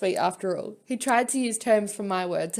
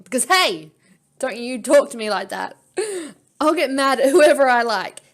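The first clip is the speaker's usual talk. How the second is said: louder, indignant retort; angry comeback; 10 dB louder than usual. Acted. Heroine snapping back at Chrome, loud and indignant. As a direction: snap back hard at him.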